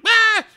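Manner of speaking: short, very high mock scream; hammed-up squeal. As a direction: shriek briefly as a panicked girl. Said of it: "And Crystal's like".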